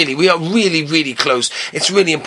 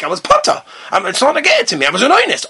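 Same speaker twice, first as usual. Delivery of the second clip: hammed-up, higher-pitched self-defence; indignant protest; 8 semitones higher than usual. Student claiming exemption to Mashgiach, mock indignant. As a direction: insist on being excused, pitch swooping up.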